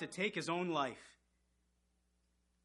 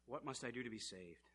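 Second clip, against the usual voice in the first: quiet repeat; 4 semitones lower, 16 dB quieter than usual. Acted.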